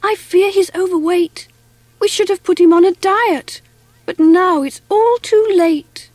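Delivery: high-pitched fretting; worried. A narrator's reading. Lucy fearing the swimmer is overweight, worried.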